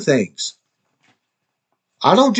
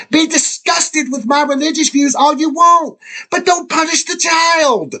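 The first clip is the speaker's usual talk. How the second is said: high, raised, pleading voice that breaks into a shout; exasperated plea; 9 semitones higher than usual. Real, not acted. Father pleading to his daughter about the custody fight, exasperated and raised. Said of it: "with the tone and the exasperation that I did".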